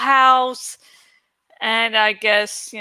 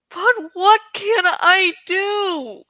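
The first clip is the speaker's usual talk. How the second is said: high, loud, tearful cries of despair; despairing Eragon; 9 semitones higher, 3 dB louder, slower than usual. Acted. Grieving young rider crying out to his dragon. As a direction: pour out his grief as he pleads.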